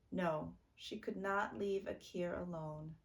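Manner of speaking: usual speaking voice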